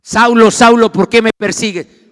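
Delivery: the Lord calling. Acted. Preacher voices the Lord confronting Saulo, loud booming call. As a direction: call out forcefully as God's voice. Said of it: "el Señor le habla".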